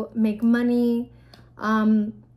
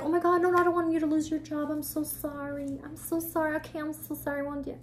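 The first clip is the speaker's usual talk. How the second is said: high, fast, then hushed panicky apologies; flustered apologetic customer; 5 semitones higher, 5 dB quieter, faster than usual. Retold or imitated. Imitating a customer to the stripper, high and flustered. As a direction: play the drunk guy suddenly begging sorry.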